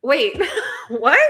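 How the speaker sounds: high, startled recognition breaking into a laugh; mistaking the neighbor for Nicole, then catching herself with a laugh; surprised double take. Retold or imitated. Retelling seeing her neighbor as Nicole, surprised then laughing. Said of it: "I'm, I'm like".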